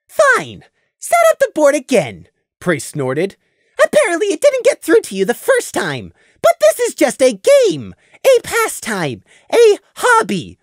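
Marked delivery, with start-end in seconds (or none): 0.1-2.3 loud and indignant; angry. A narrator's reading. Scenic angrily insists. 3.8-10.6 exasperated. A narrator's reading. Prey lectures, exasperated.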